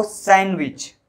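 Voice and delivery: सैंडविच said slowly as "san-wich"; slow सैंडविच, n straight into w; silent D. Shown as a demonstration. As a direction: leave the D out of सैंडविच. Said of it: "डी नहीं".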